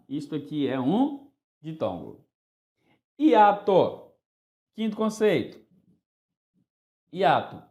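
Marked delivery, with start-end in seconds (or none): none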